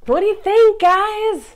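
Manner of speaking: loud high What do you think; excited. Real, not acted. Vlogger calls out excitedly to her viewers. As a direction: call out to your viewers.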